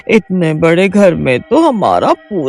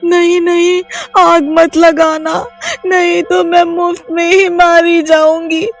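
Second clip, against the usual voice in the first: scared wife explaining the witch's power; frightened pleading; 12 semitones higher than usual. Acted. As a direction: stop him and point to the flowers. Reema pleads, explains flowers.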